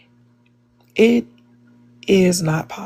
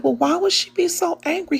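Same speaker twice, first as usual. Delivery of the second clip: high-pitched, baffled retold question; retold wondering, pitched well up; puzzled question; 11 semitones higher than usual. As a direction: ask it high, wanting to know why. Asking dad about angry lady.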